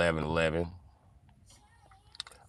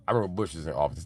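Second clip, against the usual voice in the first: normal talking voice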